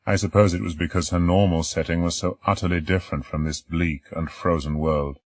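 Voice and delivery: ordinary delivery, nothing marked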